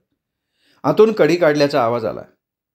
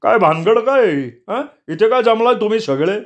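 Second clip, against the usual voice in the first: startled gruff man; 9 semitones higher than usual. A narrator's reading. Marudgan, waking up, confronts the group, irritated. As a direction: demand what's going on, just roused. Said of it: "मरुद्गण चिडून म्हणाला".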